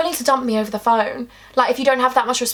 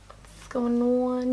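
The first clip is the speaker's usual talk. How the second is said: much softer and very slow; hushed confusion; 8 dB quieter, much slower than usual. Retold or imitated. Girlfriend puzzled when the call goes silent, hushed and slow. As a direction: ask it into the silence, unsure.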